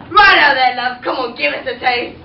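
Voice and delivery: loud, low Cockney prince. Acted. The prince flirting with Cinderella at the ball, Cockney accent. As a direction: play the prince as a cheeky London geezer.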